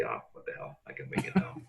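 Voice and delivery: low, soft throwaway, half-whispered; mock careless shrug. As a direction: toss it off like you no longer care. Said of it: "just say".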